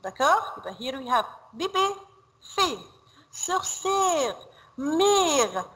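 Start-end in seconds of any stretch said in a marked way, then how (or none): 3.3-5.6 "Sorcière", "mère" with the è stretched like an Arabic madd; elongated open e in "Sorcière" and "mère"; drawn-out vowel. Shown as a demonstration. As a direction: hold the è long in "Sorcière" and "mère", then let it fall. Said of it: "عاملة زي المد شوية في العربي".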